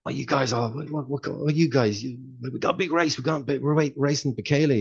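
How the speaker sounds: mock panicky runner; agitated, tense quote. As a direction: play the nervous athlete before the big race. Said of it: "He was like".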